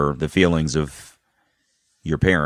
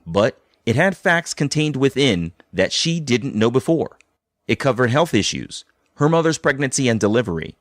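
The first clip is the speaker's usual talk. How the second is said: scripted host narration, slightly raised pitch; podcast narration; 5 semitones higher than usual. A narrator's reading. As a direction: read it like a documentary voiceover.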